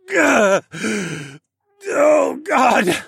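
loud, high agonised cry; choked pain. A narrator's reading. Hero rips the barb out, choking in pain. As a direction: choke out the words through agony. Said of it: "I choked".

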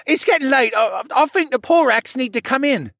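put-on high, loud flustered young character voice; high-pitched, hurried-off protest; flustered Rylan. A narrator's reading. Rylan blurts excuse while fleeing.

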